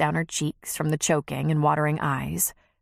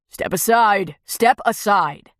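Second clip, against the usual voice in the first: deep growly voice; 5 dB louder, slower than usual. Acted. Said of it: "a deep, growly voice instructed".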